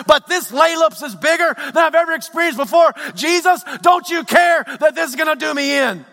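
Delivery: high, loud, fast anguished pleading; a shouting honest disciple bargaining with Jesus. Retold or imitated. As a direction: pour out a desperate complaint to God. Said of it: "Is there anybody else who says".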